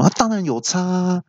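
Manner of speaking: high, indignant lecturing; mock-angry high retort. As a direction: insist it matters. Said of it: "很生气的回说".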